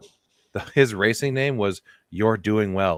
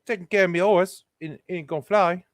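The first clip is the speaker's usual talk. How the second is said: put-on much higher, broad English accent; 9 semitones higher than usual. Correcting the neighbor's voice as English, in a high English accent. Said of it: "But he's from England".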